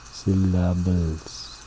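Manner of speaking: "Syllables" drawn out, stressed on a long second syllable LAB; mispronounced syllables. Shown as a demonstration. Teacher showing a word said wrongly, mispronounced syllables. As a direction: say "Syllables" slowly as si-LAB-uls. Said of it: "We don't say syllables".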